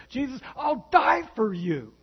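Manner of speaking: boastful Peter. Retold or imitated. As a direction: play Peter overpromising. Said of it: "to Jesus and he says".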